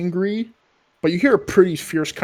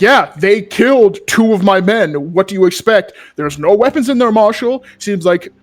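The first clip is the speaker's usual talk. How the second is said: loud, higher-pitched angry protest; [name]'s raised temper; 5 semitones higher, 10 dB louder than usual. Acted. Gang leader arguing with the Marshal about his dead men, angry. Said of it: "[name] definitely raise his temper and anger".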